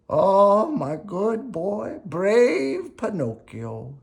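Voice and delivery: put-on high, very slow tender old-father voice; proud Geppetto. Geppetto hugging his son after the rescue, slow and tender. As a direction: praise the boy tenderly and slowly. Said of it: "said Geppetto".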